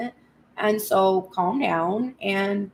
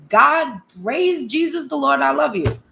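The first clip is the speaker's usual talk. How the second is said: higher, louder burst of thankful praise; fervent praise; 4 semitones higher, 4 dB louder than usual. Acted. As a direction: lift the prayer into exclaimed devotion. Acted prayer rising into praise.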